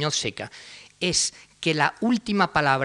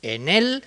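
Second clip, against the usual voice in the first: "él" hit much higher and louder; heavy accent on "él"; stress on él; 6 semitones higher, 4 dB louder than usual. Shown as a demonstration. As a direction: punch "en él" fully. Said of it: "con todo el acento".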